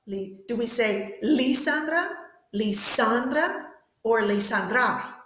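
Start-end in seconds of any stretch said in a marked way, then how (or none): 1.2-2.1 LI-sandra, weight on the first syllable, questioning; wrong first-syllable stress. Shown as a demonstration. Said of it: "so the stress is in the middle". 2.5-3.7 Lisandra with SAN drawn out and heavy, rising; middle-syllable stress. Shown as a demonstration. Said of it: "so the stress is in the middle". 4.2-5.1 li-san-DRA, weight thrown on the end; wrong last-syllable stress. Shown as a demonstration. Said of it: "so the stress is in the middle".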